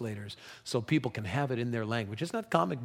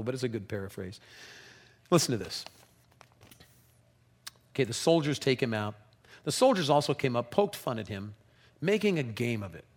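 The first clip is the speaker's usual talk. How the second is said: ordinary talk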